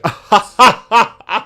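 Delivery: a mock laugh, acting out Martha laughing; imitated laugh. Retelling the scene, Martha cracks up in a mock laugh. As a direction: burst into a put-on laugh. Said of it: "She's like".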